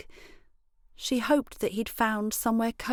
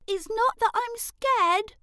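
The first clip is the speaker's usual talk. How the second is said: put-on girl's shout; 13 semitones higher, 4 dB louder than usual. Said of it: "shouted out Elray's shout".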